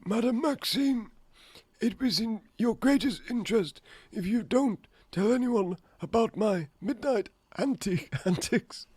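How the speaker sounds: high-pitched put-on voice; headmaster character. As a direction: play a coy headmaster in a high voice. Acts headmaster talking to Madame Maxine.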